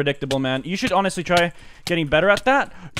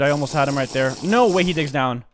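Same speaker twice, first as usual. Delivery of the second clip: usual speaking voice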